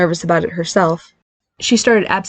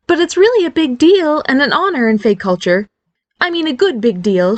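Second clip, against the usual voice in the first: higher, eager mother's voice, explaining; a mother gently explaining family magic; voiced mother; 8 semitones higher than usual. Mother reassuring husband on phone.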